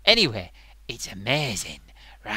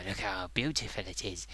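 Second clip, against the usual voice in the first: ordinary talk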